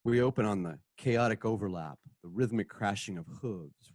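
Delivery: normal talking voice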